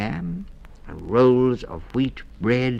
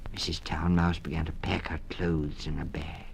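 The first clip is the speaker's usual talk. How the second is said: ordinary delivery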